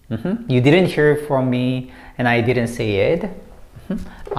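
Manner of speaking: normal talking voice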